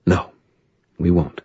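cracking voice, held-back tears. A narrator's reading. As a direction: let her voice break on it. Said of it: "Her voice cracked".

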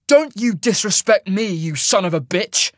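high, loud, furious insult; snarled. A narrator's reading. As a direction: snarl it up at the giant. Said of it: "he snarled".

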